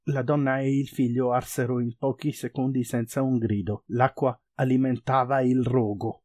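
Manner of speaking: usual speaking voice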